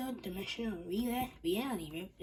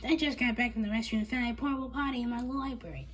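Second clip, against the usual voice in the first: angry; 5 semitones higher, 6 dB louder than usual. Acted. Angry librarian continues.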